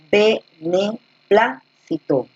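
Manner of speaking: beneplácito said very slowly, leaning on plá; teacher slowly sounding out beneplácito's stress. Shown as a demonstration. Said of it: "Plácito, tilde acá".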